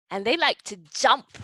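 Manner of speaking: ordinary talk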